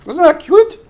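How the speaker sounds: high, loud delighted squeal; thrilled little exclamation. Real, not acted. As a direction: gush over how cute it was. Delighted reaction to diet sign.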